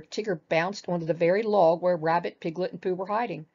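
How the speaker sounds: plain reading voice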